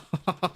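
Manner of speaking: short high chuckle; brief real laugh mid-commentary. Real, not acted. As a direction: let a quick high laugh escape. Amused by a player's mistake.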